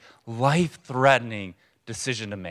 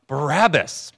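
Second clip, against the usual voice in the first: louder, higher mock shout; crowd shout; 5 semitones higher, 5 dB louder, slower than usual. Preacher imitating the crowd answering Pilate, shouting out. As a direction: call the name out like a crowd. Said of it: "the crowd shout out".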